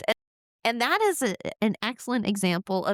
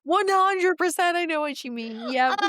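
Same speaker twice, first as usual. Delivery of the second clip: higher, breaking into laughter while agreeing; laughing hard; 9 semitones higher than usual. Real, not acted. Agreeing with the host while laughing at her joke. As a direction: barely get the words out through laughter.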